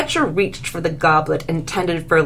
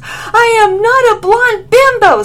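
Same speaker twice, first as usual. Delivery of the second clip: much higher, much louder tearful scream; 9 dB louder than usual. A narrator's reading. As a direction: scream it through tears. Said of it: "screamed Lily".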